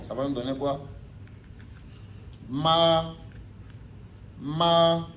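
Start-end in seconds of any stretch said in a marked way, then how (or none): 2.5-5.1 ma held high and level; steady high "Ma" in Mandarin first tone. Shown as a demonstration. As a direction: sing "ma" on one high flat pitch. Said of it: "si on applique le premier ton".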